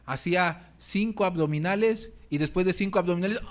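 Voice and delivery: ordinary delivery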